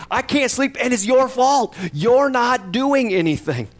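high, pained cry of blame; pleading complaint to God. Retold or imitated. Psalmist blaming God for sleepless nights, in a high pained plea. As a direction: voice climbs high as he accuses God.

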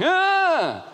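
imitated child's high scream; very high mock shriek; high-pitched yelp. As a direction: mimic a kid's high squeal. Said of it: "kinda at a high pitch".

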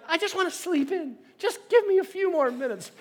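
high, mock-desperate whine; pleading to sleep. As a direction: beg in a hammed-up high voice.

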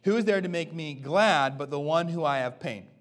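loud, deliberate scripture read. Acted. Pastor reading Paul's letter aloud to church, proclaiming. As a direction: proclaim the verse to the congregation.

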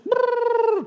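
loud, high rolled trill; trilled chatter noise. Retold or imitated. Storyteller imitating village talk erupting outside, loud high trill. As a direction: burst out a high tongue trill.

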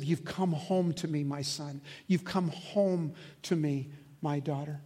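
put-on soft, slow, tender father welcoming a child home; gently, like a loving father at the door; God's tender welcome. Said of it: "it's the sense of".

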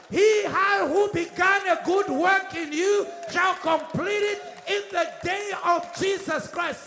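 loud, rousing declaration of scripture; full-force preaching. Real, not acted. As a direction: build from firm address to a shouted proclamation.

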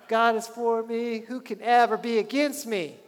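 triumphant faith cry; excited proclamation. Shown as a demonstration. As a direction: call it out in your backyard. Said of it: "this is kinda how it looks".

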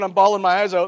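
usual speaking voice

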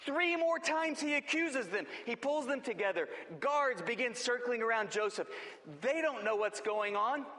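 usual speaking voice

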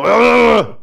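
irritated groan at the inbox; frustrated ugh. Retold or imitated. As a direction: let out a fed-up "ugh" at an email. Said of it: "I tend to wanna go".

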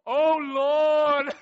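huge, high, drawn-out mock wail to God; loud pleading. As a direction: imitate Dad crying out in desperate prayer. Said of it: "I heard him plead with the God".

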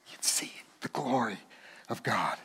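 whisper. Shown as a demonstration. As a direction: breathe the words in awe. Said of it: "repeats as whisper".

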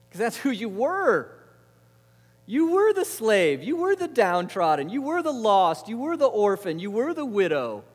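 high, loud, insistent proclamation; impassioned preaching. Acted. God reminding Israel who they were, in impassioned preaching. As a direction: drive each repeated phrase home to the crowd.

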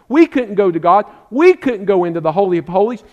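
normal talking voice

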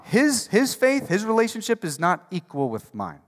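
loud, driving sermon delivery; rising revival preacher; full-force preaching. Acted. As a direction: preach it out to the whole room.

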